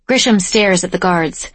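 ordinary delivery, nothing marked

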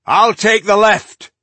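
loud, high-pitched battle call; battle shout. A narrator's reading. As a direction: shout it out to comrades mid-charge. Dwarf calls out in battle.

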